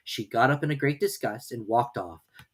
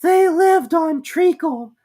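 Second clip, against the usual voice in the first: high, slow, drawn-out mouse voice; slow squeaky Dormouse; 10 dB louder, much slower than usual. A narrator's reading. Dormouse answering Alice after long thought. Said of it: "after thinking a minute or two".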